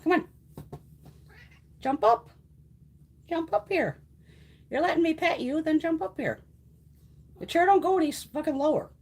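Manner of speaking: urging cat. Real, not acted.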